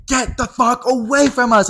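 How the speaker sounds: high, slow, mocking yell at the guy; mock angry shout. Angry boys yelling at Rocky to back off, high mock shout. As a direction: throw the line at him like a catty brat. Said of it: "they're like".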